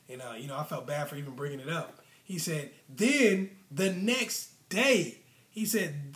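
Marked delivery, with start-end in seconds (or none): 2.9-5.2 THEN, NEXT and DAY drawn out slowly and louder; slow, loud, incredulous stress on "next day"; emphatic retelling. Retold or imitated. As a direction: punch "the next day" word by word. Retold shock twist, drawn out.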